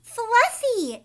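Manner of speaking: high, loud cry of a name; a girl crying out at the door. Acted. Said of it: "Jasmine cried".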